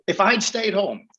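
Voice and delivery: broad Manchester accent; northern English accent. Shown as a demonstration. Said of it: "I'd be speaking like this now".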